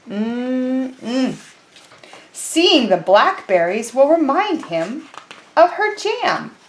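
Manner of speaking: slow storybook reading voice; reading to children. Acted. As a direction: read it slowly, like story time. Reads picture book aloud.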